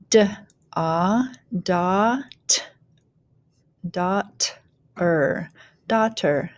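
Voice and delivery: "daughter" built up from d, ah, dot, er; teacher blending "daughter" with gaps between sounds; phonics blending. Shown as a demonstration. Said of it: "go ahead and start blending".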